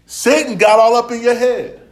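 loud, high preacher's charge; emphatic accusation. Retold or imitated. Preacher telling David what Satan did, loud emphatic accusation.

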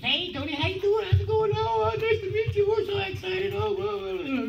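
very high-pitched mimicry of a chatty girl; gushing wardrobe girl. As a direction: gush on and on in a squeaky put-on voice. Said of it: "She's going on and on".

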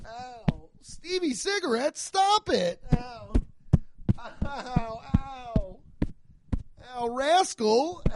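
high-pitched play-acted ows; mock pained yelps. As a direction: yelp high in pretend pain, calling out biker names. Mock gang initiation, calling out names.

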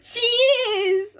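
high, exclaimed agreement; delighted squeal. Real, not acted. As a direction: squeal your agreement, stretching the last word. Delighted agreement about the dog.

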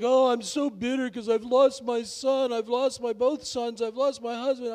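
high, slow, whiny self-pity; mock-moan her losses like a sulking widow; bitter Naomi. Preacher mocking a bitter Naomi, high whiny lament. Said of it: "Naomi's like".

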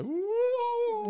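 high spooky witch hoot; witch woo. Retold or imitated. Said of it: "like a witch going like".

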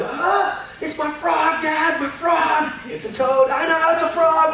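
put-on high, excited child's voice insisting it's a frog; excited little daughter. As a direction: play the overjoyed little girl. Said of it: "every time she saw the toad".

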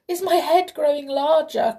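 high, loud alarmed cry. Acted. King feeling his head change, crying out in alarm. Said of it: "cried the king".

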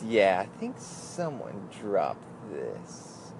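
very slow, hushed and loaded hint; weird, suggestive. Retold or imitated. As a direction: draw it out softly, as if passing a secret message. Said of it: "she kinda said in a weird way".

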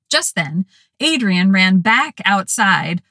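plain reading voice

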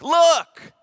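look, high and loud like a cry; shouted look. Retold or imitated. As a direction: shout it, pointing out. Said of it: "a better word for us to understand is look".

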